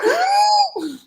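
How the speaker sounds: big exaggerated gasp for air; hammed-up winded gasp; mock gasp. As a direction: gasp like you can barely breathe. Wilder out of breath entering ring.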